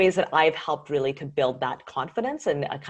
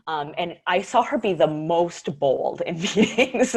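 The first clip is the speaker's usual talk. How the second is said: ordinary talk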